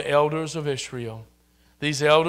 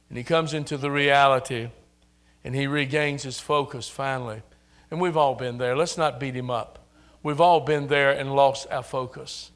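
ordinary talk, nothing marked

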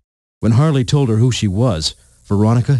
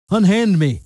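higher-pitched, contained cry of protest; understated shout; 10 semitones higher than usual. A narrator's reading. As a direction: read it as a shout without much added volume. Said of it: "shouted Professor Jonathan Crane".

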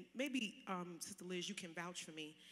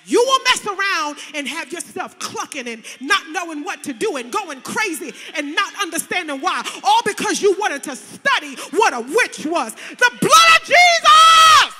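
preaching at full force; 4 semitones higher, 17 dB louder than usual. Acted. Preacher warning her congregation off witchcraft, loud and building. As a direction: build the fire to a shouted climax.

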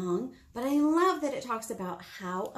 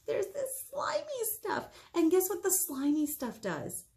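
much higher, slowed, mock grossed-out; icky voice; 9 semitones higher than usual. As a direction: stretch out "slimy" as if it's yucky. Describing pumpkin goo to kids.

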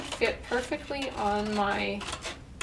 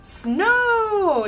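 excited; 12 semitones higher, 10 dB louder than usual. Acted. Children spot snow excitedly.

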